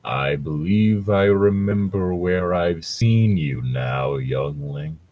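slow, deep ancient Ent; ancient tree voice. Acted. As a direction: rumble it like an old tree.

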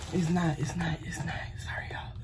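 hoarse whisper from coughing; smoker's rasp. Real, not acted. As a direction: voice catching on a cough. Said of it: "Smoker's cough".

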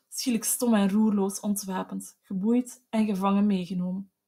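ordinary delivery, nothing marked

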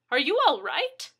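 loud, high worried question; alarmed cry. A narrator's reading. Neighbor checking on the injured man, alarmed cry. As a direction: ask it in rising alarm. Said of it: "she cried".

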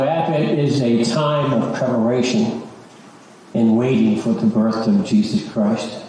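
normal talking voice

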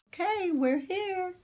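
high playful voice. Acted. Arriving with a high voice.

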